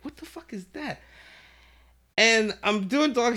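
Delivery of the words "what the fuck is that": high, very soft bewildered question; hushed alarm. Retold or imitated. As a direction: ask it small and high, dreading the answer. Feels something unknown behind him.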